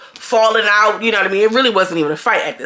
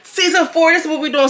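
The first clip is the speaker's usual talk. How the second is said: hyped; 7 semitones higher than usual. Retold or imitated. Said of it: "Now she hype".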